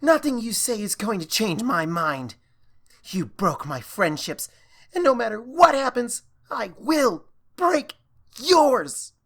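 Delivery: loud, high furious threat. Acted. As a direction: vow revenge at full volume. Starlight's furious vow of revenge.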